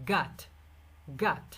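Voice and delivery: গাট said American style with an open আ vowel; American got. Shown as a demonstration. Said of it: "নেটিভরা বলে কি". (American উচ্চারণে গাট, খোলা আ ধ্বনি)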